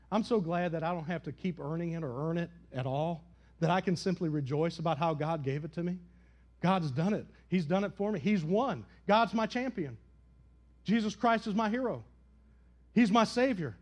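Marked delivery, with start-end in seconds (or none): none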